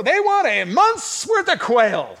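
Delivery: high, slow announcer voice; slow, showy prize announcement; game show prize reveal. Pretend. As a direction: sell the prize like a TV host. Announcing the prize to Don Pardo.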